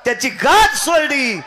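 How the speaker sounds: high, loud kirtan cry to the gods; preaching at full force. Acted. Preacher crying out to the gods in kirtan. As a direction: belt it out like a temple preacher.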